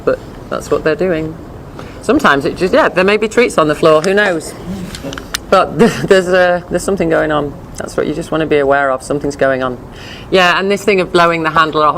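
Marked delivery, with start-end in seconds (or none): none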